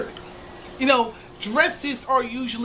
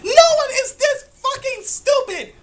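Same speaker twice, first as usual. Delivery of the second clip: much higher, louder, laughing scream; 12 semitones higher, 5 dB louder than usual. Real, not acted. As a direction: scream in disbelief. Screaming in disbelief.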